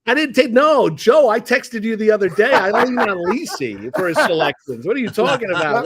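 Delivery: loud, high-pitched playful objection; animated protest. Real, not acted. Guest to host who asked him for a pick, animated protest. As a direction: push back loudly, voice jumping up.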